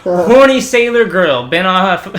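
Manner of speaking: high, loud, slow ad read; mock personal ad. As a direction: announce it hammed-up, drawn out. Acts out her prank Craigslist ad.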